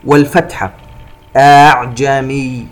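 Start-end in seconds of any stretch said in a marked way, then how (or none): 1.4-2.7 slow chanted "أعجمي" gliding over the second hamza; softened second hamza. Shown as a demonstration. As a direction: ease the second hamza of "أعجمي" towards a vowel. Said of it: "الهمزة الثانية مسهلة". (تسهيل الهمزة الثانية في "أعجمي")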